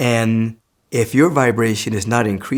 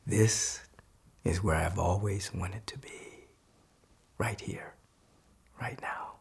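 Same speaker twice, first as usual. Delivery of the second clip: softer, breathy whispered affirmation; 2 semitones lower, 12 dB quieter, slower than usual. Acted. Teacher whispering the affirmation for the class. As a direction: whisper it to yourself, slowly.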